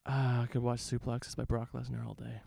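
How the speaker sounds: usual speaking voice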